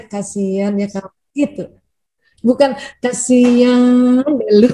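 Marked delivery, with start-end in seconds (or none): 0.0-1.1 soft, tender "Kasihan ya kamu", voice lowered and quieter; gentle sympathy. Shown as a demonstration. Partner comforting a tired spouse, soft and tender. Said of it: "Cuman intonasinya yang bagus ya". 3.0-4.7 slow, sarcastic "Kasihan deh lu", dragged out and louder; mocking sympathy. Shown as a demonstration. The wrong way to answer a spouse, mocking and slow. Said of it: "bukan yang begitu".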